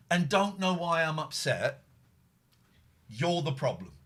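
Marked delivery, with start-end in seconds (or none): none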